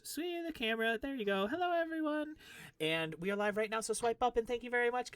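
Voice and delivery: high, singing-out greeting to viewers; Snapchat presenter voice. Pretend. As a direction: chirp it high for the phone camera.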